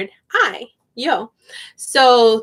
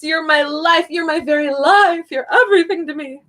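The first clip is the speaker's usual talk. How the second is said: higher-pitched playful gushing; a little dramatic; 5 semitones higher than usual. Acted. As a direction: pile on the adoration, half laughing. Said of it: "being a little bit dramatic".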